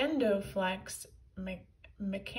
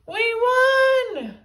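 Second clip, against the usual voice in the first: high, loud victory cheer; gleeful hero; 14 semitones higher, 14 dB louder than usual. A narrator's reading. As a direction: crow over the win. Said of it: "Captain Underpants laughed at Tippy".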